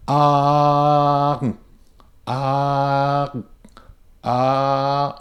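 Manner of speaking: ah held steady with no R glide; pure ah. Shown as a demonstration. Teacher shows a pure ah sound. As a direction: sustain ah evenly to the end. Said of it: "So here's what I mean".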